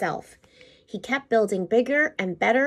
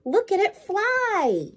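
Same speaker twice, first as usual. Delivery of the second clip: much higher, slow delighted call; Billy watching the plane climb, higher; excited kid; 10 semitones higher, slower than usual. A narrator's reading. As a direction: marvel at the flying plane. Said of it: "said Billy".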